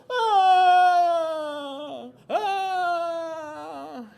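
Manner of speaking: put-on long high baby wails; loud mock crying. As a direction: bawl at full volume like a baby. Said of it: "cried even louder".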